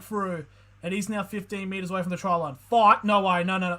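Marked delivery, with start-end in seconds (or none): 2.7-3.8 excited shout. Real, not acted. Excited reaction to the game.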